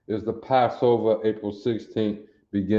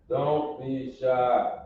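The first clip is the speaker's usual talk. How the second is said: drawn-out coax; 3 semitones higher, 4 dB quieter, much slower than usual. Real, not acted.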